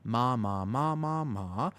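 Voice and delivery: "Ma, ma, ma, ma, ma" in five different Thai tones; Thai tones demo. Shown as a demonstration. Streamer demonstrating Thai tones to viewers, five tones on "ma". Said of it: "So Thai goes like this".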